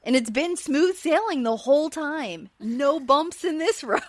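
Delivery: high, loud mock cheer; imitated sarcastic husband, exaggeratedly upbeat. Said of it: "Kyle sarcastically is like".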